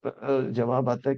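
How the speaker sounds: ordinary delivery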